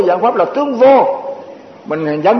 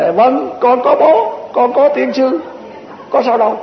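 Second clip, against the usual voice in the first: mock meek yes; 5 semitones higher than usual. Mock meek acceptance of curse.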